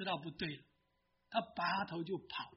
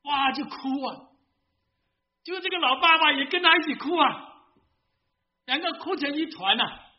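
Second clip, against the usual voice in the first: louder, higher, swept up in the weeping scene; emotional retelling; 8 semitones higher, 10 dB louder than usual. A narrator's reading. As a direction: tell the crying with rising emotion. Preacher tells men weeping together. (激动地讲述两人痛哭)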